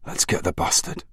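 unvoiced, grim whisper. A narrator's reading. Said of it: "he whispered".

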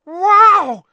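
huge, very high wow; amazed exclamation. A narrator's reading. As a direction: squeal it in total awe. Thrilled boy exclaims.

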